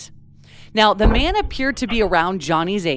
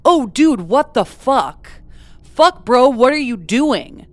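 raised, higher mock yell, understated; restrained reenacted yell; retold yelling; 6 semitones higher, 6 dB louder than usual. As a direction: quote someone yelling in alarm without fully shouting. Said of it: "he can be heard yelling".

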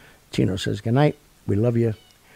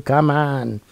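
usual speaking voice

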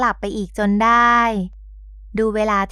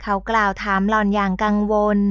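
ordinary delivery, nothing marked

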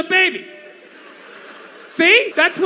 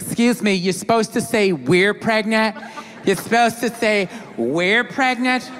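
mocking scold; 4 semitones lower than usual. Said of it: "I always have these people like".

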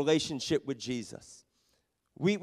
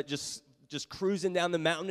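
usual speaking voice

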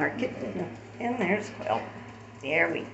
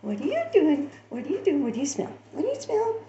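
light high puppy voice, curious; voicing the puppy; 10 semitones higher, faster than usual. Owner voicing the puppy's curious questions in a high voice. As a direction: ask like a curious little pup. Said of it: "She's like".

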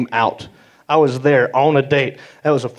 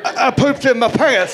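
put-on higher little kid laughing it out; 6 semitones higher than usual. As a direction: confess like a sheepish kid. Kid after the scare.

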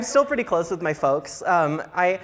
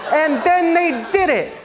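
high loud punchline; 8 semitones higher, 5 dB louder, slower than usual. Acted. Punchline shouted.

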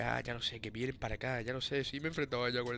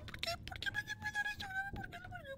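high, hammed-up fake sobbing wail; mock crying; 8 dB quieter than usual. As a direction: whine like a crying child, overdone. Mock wailing at the game.

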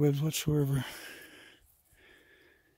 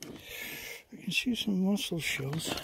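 slow, softer repeat; 3 semitones higher, 6 dB quieter, slower than usual. Shown as a demonstration. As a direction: draw it out slowly and quietly. Repeats the same sentence twice.

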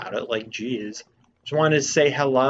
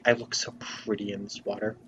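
soft, lower, preening; vain Link voice; 3 semitones lower, 6 dB quieter than usual. Retold or imitated. Voicing Link admiring his reflection, soft and preening. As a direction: coo it like a vain pretty boy. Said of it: "Link is just like".

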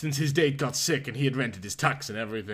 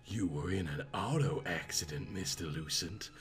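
soft, low Southern belle reassurance; sultry Southern accent; 7 semitones lower, 7 dB quieter than usual. Acted. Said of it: "the accent of a sexy southern peach".

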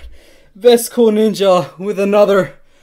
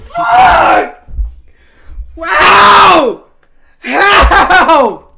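shrieking disbelief, far higher and louder, slow; stunned screaming; 9 semitones higher, 10 dB louder, much slower than usual. Real, not acted. Streamer screaming at chat over a fifty dollar donation. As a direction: scream like you can't believe it.

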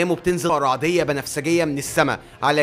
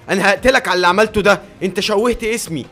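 loud, higher-pitched indignant outburst; angry threat; 4 semitones higher, 5 dB louder than usual. Acted. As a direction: cut in furious and threatening, acted. Acted threat cutting off villain. (بغضب وتهديد)

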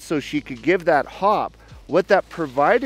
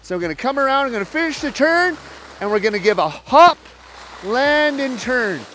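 raised, louder voice over the wind while skiing; live on-slope coaching; 6 semitones higher, 5 dB louder than usual. Real, not acted. Ski coach calling instructions to students mid-run, raised and loud. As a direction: call it out loud as you ski the drill.

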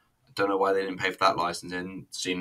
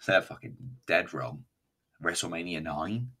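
ordinary delivery

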